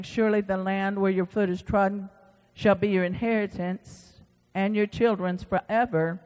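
normal talking voice

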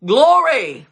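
loud, high cry of praise; exultant acclamation. Acted. Everyone in the temple says it, exultant shout. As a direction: sing out the word in full-voiced praise. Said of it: "everyone says".

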